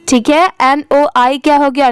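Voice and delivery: ordinary delivery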